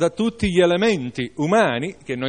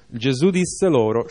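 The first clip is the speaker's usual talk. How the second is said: ordinary delivery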